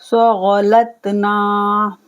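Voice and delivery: सगलतना very slow with thick गैन; slow heavy ghain. Shown as a demonstration. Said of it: "गैन मोटा पढ़ा जाएगा".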